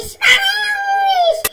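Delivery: high, slow, loud drawn-out cry on disparamos; triumphant drawn-out shout; stretched excited shout. Real, not acted. Stretched repeat of the word.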